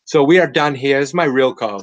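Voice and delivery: usual speaking voice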